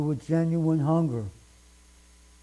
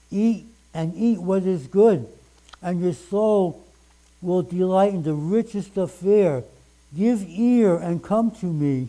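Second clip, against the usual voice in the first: louder, raised proclaiming of the verse; fervent scripture reading; 2 semitones higher, 4 dB louder than usual. Acted. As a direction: call out the invitation like a preacher at full force. Preacher reads scripture aloud.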